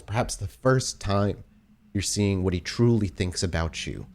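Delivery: usual speaking voice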